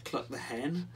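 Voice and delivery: plain reading voice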